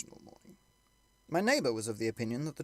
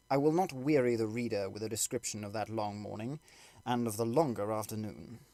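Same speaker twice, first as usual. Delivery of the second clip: ordinary delivery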